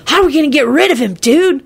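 put-on quick, cheeky kid voice; rude blurt. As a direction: blurt it out rudely. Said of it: "Kenny blurted out rudely".

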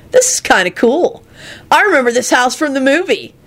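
a sulky dude yelling the same phrase; high exasperated slacker voice; high whiny stoner, irritated. Acted. Kenny voice.

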